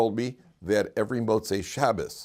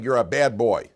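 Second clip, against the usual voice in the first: a hint of a scolding parent; mock scold, a bit louder; 2 semitones higher, 6 dB louder than usual. Parent scolding a misbehaving son, mild mock scold. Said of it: "Instead of saying".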